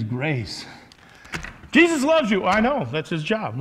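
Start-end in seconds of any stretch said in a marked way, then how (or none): 1.7-3.6 high, loud mocking sing-song; mock sweet. Preacher mocks a cheap grace message in a high, loud voice. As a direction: parody a syrupy preacher.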